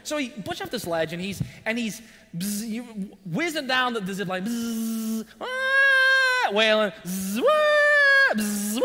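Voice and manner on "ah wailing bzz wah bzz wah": high shrieking wail with buzzing noises, much louder; hammed-up child shriek with buzz sound effects; imitated child wail. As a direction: mimic a kid screaming down a zip line. Imitates son wailing down zipline.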